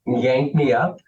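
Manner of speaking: usual speaking voice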